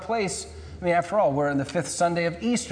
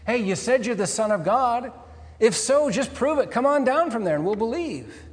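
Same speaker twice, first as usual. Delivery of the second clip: higher-pitched mocking challenge from onlookers; called-out taunt; 6 semitones higher than usual. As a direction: call it out like a jeering heckler. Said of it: "called out insults".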